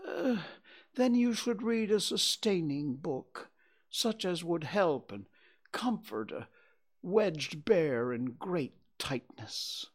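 mock-tearful, starting very high; tearful bear. A narrator's reading. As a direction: plead pitifully as a tearful bear. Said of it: "A tear rolled down his eye".

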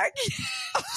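very high laughing cry; giggling squeal. Real, not acted. Trying to do his accent, breaking into high laughter.

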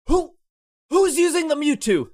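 a raid guy mocked with a high whine; mocking demand. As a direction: demand it like a petty gatekeeper.

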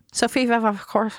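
ordinary delivery

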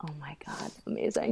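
whispered aside; whispering. Real, not acted. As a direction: breathe it in a hushed whisper.

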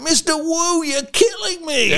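put-on very high, laughing New York accent. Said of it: "And he's proper New York".

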